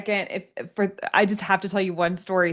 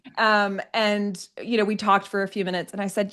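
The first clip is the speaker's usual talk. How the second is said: normal talking voice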